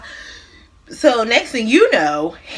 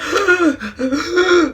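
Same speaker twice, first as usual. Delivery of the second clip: mock panicked gasp; 5 semitones higher than usual. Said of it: "He like".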